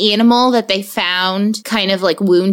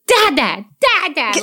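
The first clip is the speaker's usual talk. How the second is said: imitating a cross little kid, higher pitched; pissed-off toddler; 6 semitones higher than usual. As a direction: scold in short toddler bursts. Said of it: "Like he gets pissed at me".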